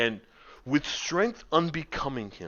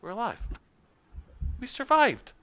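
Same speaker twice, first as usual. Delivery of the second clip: rising, higher voice of disbelief; dawning relief, getting higher; relieved Norin; 12 semitones higher than usual. Acted. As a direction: Norin realizing everyone made it, getting higher. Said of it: "he goes".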